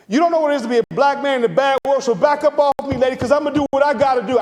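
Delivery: high, loud, fast defiant rant; tough-guy speech. Retold or imitated. Rehearsed tough speech to his mother, loud defiant rant. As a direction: puff up and warn her off, fast and loud. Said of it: "And so I was like".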